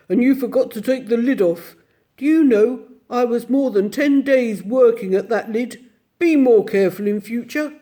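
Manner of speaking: a lightly voiced gruff bear gatekeeper; a hint of a deep gruff doorman. Bear at the gate welcoming the children, lightly gruff. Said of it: "deep, gruff voice".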